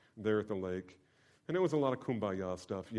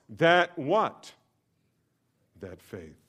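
ordinary delivery

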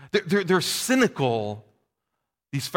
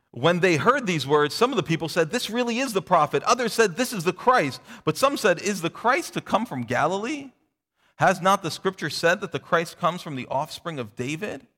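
measured, slightly raised reading voice; scripture reading; 4 semitones higher than usual. Acted. Pastor reads scripture aloud to the congregation, measured. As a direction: read the passage out to the congregation.

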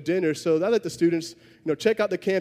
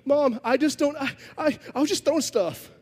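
put-on higher, whiny teenage-boy voice; sheepish teen excuse; 8 semitones higher than usual. Pastor voicing the student excusing himself to his mom, high whiny plea. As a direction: play the caught kid mumbling his excuse upward. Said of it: "But he just says".

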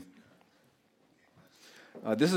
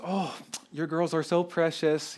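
a hint of a gushing cashier, higher and slow; sweet cashier lady; 6 semitones higher, slower than usual. To the preacher's daughters in line, lightly gushing. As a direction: coo it lightly like a charmed shop lady. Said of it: "the lady goes".

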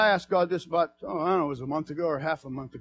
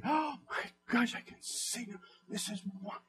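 1 hushed amazement; 6 semitones higher, 9 dB quieter than usual. Pretend. Voicing a believer who sees.

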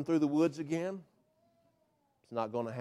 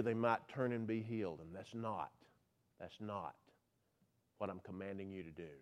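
much lower and much softer; dropped voice; 7 semitones lower, 15 dB quieter than usual. Acted. Preacher voicing God's command to Isaiah, very low and soft. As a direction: sink almost to a murmur. Preacher drops voice mid-quote.

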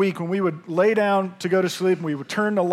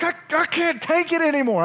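desperate; 9 semitones higher than usual. Retold or imitated. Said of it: "And I'm like".

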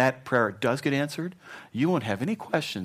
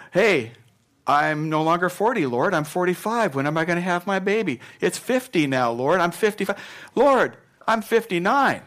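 mock-pleading impatient old man, raised and rising; impatient Isaac; 8 semitones higher, 5 dB louder than usual. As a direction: whine at the Lord about the wait. Pastor voices Isaac's impatient prayer.

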